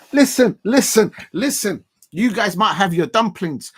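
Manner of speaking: hitting back at the chat, loud; loud playful comeback. Real, not acted. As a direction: demand attention loudly. Firing back at Damo.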